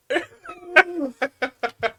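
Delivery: squealing laughter; high laughter. Real, not acted. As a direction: let a high laugh burst. Laughs at joke.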